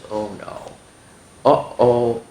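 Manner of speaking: plain reading voice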